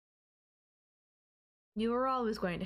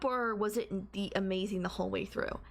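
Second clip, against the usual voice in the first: usual speaking voice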